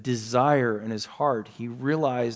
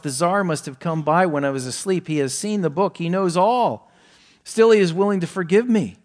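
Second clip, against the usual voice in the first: rising higher and louder to relief; amazed realization; 6 semitones higher, 7 dB louder than usual. Acted. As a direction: let the wonder build to forgiveness. Young man reasons to himself.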